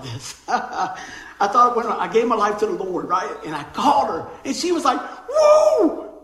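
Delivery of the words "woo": high, loud "woo!" of sheer delight; excited whoop. Retold or imitated. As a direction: imitate an overjoyed mother-in-law whooping. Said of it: "She was all excited".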